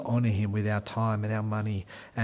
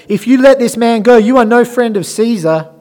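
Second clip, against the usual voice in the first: high, loud crowd shout at Pilate; leaders shouting; 9 semitones higher, 11 dB louder than usual. Acted. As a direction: shout it like an angry mob.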